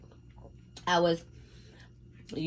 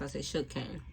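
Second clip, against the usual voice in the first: "sugar cane" run together quickly and softer; said fast; 8 dB quieter, faster than usual. Shown as a demonstration. Vlogger showing how the name sounds said fast, quick and soft. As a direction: rush "sugar cane" into one quick word. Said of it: "I said, say it fast".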